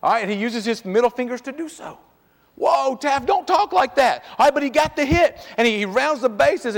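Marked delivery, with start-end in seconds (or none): 2.5-4.2 high, loud mock "Whoa, Taft"; a raised scolding dad call; father's rebuke. Father calling to his cheering son on the bases, in a high scolding voice.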